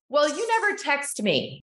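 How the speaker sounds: retorting high, a touch defensive; defensive comeback. Retold or imitated. Mom retelling her defensive reply to her upset child, mock defensive. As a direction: toss the blame back, lightly mocking yourself. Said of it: "I was defensive".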